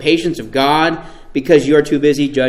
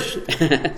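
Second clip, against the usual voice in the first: laugh; 7 dB quieter than usual. Real, not acted. Laughing at own stumble.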